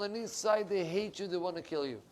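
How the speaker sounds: ordinary delivery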